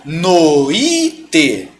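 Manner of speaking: "Noite" drawn out as no-Í-te; three long syllables in "Noite", no, I, te; exaggerated hiatus. Shown as a demonstration. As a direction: stretch and stress a separate I in "Noite". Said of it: "essa força pra se separar".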